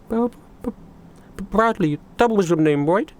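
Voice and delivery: high, halting character voice with stammered starts; Goldie. Acted. Goldie walks up to Bradley, hesitant and high-voiced. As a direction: stumble shyly over the name in a high voice. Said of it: "Goldie, uh, walks up to you and goes".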